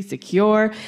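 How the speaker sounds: ordinary delivery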